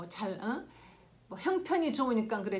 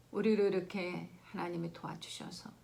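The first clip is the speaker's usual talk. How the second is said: ordinary delivery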